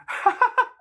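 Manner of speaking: a sudden high burst of laughter. Real, not acted. Teller laughing at his own running impression, high burst. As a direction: crack up, high and loud.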